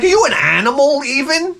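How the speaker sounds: high incredulous question to the dog; mock disbelief. Owner asking his clumsy dog, high incredulous question. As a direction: raise it up, baffled at the dog. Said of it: "I'm like".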